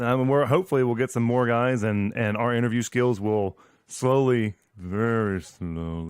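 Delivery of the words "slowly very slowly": very slow, lingering on each word; slow-motion delivery, slowing further as it goes; drawn out slowly. Shown as a demonstration. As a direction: stretch the words right out. Drags out slowly for effect.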